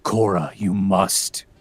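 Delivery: deep, slow character voice; low, drawn-out mock-stern dad. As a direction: drop low and command slowly, like a big character. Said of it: "going into that lower register".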